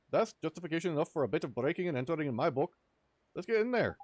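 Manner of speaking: excitable Welsh sidekick, loud and high; chirpy fairy scolding his mate. Acted. Voices Drippy in game dialogue.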